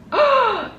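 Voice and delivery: high, voiced gasp; excited gasp. Real, not acted. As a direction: gasp as you spot a favorite. Spots Studio Ghibli stickers.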